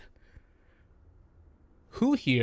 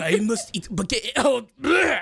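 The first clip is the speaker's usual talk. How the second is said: fake European accent; 5 semitones higher than usual. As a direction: play a cartoon European. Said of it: "this is his European accent".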